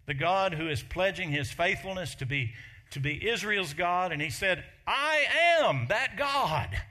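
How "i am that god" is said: loud, high, slow I am that God; booming claim. Acted. Jesus telling his enemies who he is, booming and slow.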